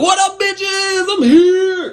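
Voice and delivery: high, drawn-out hollered entrance; storming-in shout. Acted. Storming into church, hollering a greeting. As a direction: holler it slow and high, announcing yourself. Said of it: "storm into church and be like".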